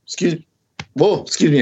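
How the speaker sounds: interrupted by a hiccup. Real, not acted. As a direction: hiccup mid-word. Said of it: "I got the hiccups all of a sudden".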